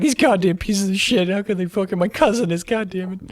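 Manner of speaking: imitated cursing, trailing away in volume; angry grumbling. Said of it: "he walks off all angry".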